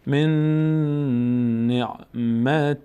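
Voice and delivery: "من نعمت" chanted with a long joined N and a hummed ending; merged, hidden noon. Shown as a demonstration. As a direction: slowly hum the N sounds in "من نعمت".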